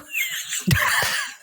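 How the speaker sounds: very high, breathless burst of laughter; shrieking laugh. Real, not acted. Collapses laughing over the word.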